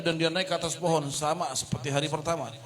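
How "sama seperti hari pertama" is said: normal talking voice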